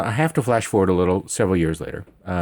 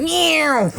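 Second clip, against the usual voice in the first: much higher squeaky mock meow; high cartoon cat meow; imitated meow; 3 dB louder than usual. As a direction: mew like a startled cat. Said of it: "And I was like".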